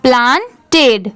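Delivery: "प्लांटेड" slowly, broken as प्लान and टेड; split into syllables. Shown as a demonstration. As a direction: separate the two parts of "प्लांटेड". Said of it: "दोनों मिला देंगे".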